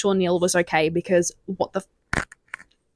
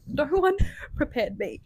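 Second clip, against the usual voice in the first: usual speaking voice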